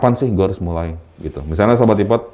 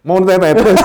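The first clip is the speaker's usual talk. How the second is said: high laughing reply; real laughter; 7 dB louder than usual. Real, not acted. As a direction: laugh it out, amused. Laughs at host's joke.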